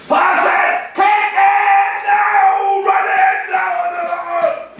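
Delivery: high-pitched frantic shouted orders; overbearing coach shouting non-stop; yelling sideline coach. Acted. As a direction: scream instructions from the touchline, far above your normal pitch. Mimics coach yelling orders during play.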